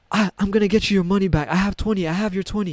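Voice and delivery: high, fast imitation of a worked-up man; snapped, frantic. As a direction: act out frantic insistence. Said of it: "something snapped and he was like".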